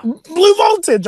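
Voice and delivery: high, slow, savouring diva. Pretend. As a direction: relish it like a spoiled star. Diva bit.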